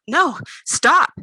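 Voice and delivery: a loud, high cry to stop; desperate shout. Acted. Pinned thief shouts at the man patting her down, loud and high. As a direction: yell it while fighting free. Struggling against pat-down.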